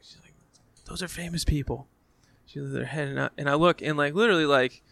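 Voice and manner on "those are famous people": hushed, secretive aside; retold freak-out under the breath; hushed alert. Starstruck partner discreetly points out celebrities.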